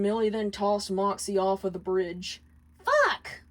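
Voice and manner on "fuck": high, sharp angry curse; 1 furious curse. Acted. Millie cursing as her husband snags on the bridge, enraged. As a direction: spit the curse out in rage. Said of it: "Millie stomped her foot in rage".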